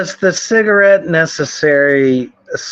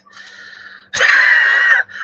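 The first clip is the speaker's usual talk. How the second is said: mocking high laugh.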